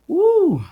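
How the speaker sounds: high, loud cry of release; joyful whoop. Real, not acted. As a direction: let the feeling burst out in one whoop. Overcome with emotion, feeling the spirit.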